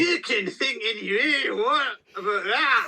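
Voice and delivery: high-pitched hammed-up Scottish accent. Co-host spoofs the director's response, high Scottish accent. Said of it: "do a Scottish accent".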